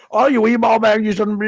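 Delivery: high, loud mock Scottish stand-up jab; thick Scottish accent. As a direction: heckle someone in a broad Scottish accent. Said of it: "his accent".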